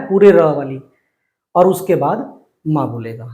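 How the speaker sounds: usual speaking voice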